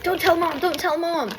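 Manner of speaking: high, hurried-sounding begging; mock urgent plea. Older sibling to little brother, high mock pleading.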